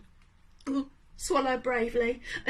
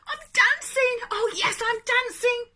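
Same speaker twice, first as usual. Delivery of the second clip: much higher, louder elated shout; joyful cry; 11 semitones higher, 6 dB louder than usual. Acted. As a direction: burst out with pure delight. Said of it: "Gerald cried".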